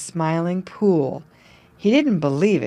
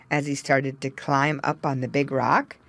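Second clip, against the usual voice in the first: ordinary delivery, nothing marked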